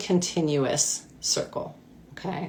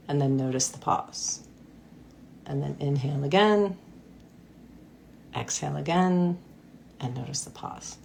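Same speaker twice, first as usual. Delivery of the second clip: guided breathing. Acted. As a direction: ease them through each breath, unhurried. Leads a breathing exercise.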